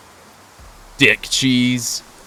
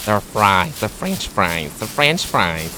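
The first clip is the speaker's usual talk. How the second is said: lower-pitched mock North American accent; put-on teasing accent; 4 semitones lower, slower than usual. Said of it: "that accent is just to annoy Kitty".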